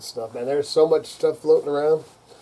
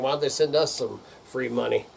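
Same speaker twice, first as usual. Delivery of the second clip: ordinary talk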